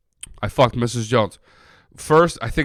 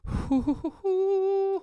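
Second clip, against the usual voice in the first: high laugh; 5 dB quieter than usual. Real, not acted. Laughs at his own rant.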